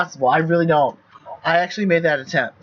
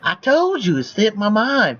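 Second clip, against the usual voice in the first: higher-pitched defensive protest; 4 semitones higher than usual. Acted. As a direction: protest your innocence, pitched up.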